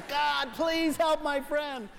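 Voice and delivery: mock over-the-top praying at the office; showy loud prayer. Coworker praying for friend at work, hammed-up loud slow plea. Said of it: "lift your hands to the heavens and say".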